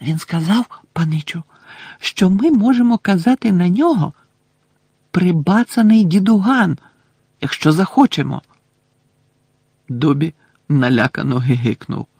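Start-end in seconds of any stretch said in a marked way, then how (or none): none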